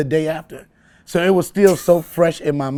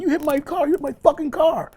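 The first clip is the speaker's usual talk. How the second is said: imitated snapping; 10 semitones higher than usual. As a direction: imitate an irate driver in a raised pitch. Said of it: "this dude come into the car snapping".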